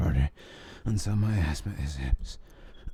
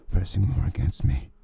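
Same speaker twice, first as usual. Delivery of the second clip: usual speaking voice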